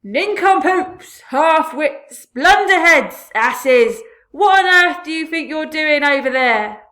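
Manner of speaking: very loud insulting yell; yelling as loud as he can. A narrator's reading. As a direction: hurl the insults at full volume. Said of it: "yelling at the Cloudmen as loud as he could".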